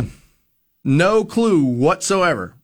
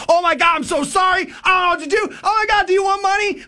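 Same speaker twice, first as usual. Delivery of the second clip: high, frantic sorry; apologizing to a stranger after crashing into his wife; panicked apology; 13 semitones higher than usual. Retold or imitated. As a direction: babble in a panic. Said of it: "Freaking out".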